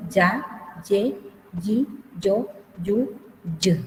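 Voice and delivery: "ya, ye, yi, yo, yu, y" each set apart, with a Y onset; Guaraní syllable drill. Shown as a demonstration. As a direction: drill ya to y one syllable at a time. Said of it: "así como diríamos". (sílabas separadas con ye inicial, de "ya" a "y")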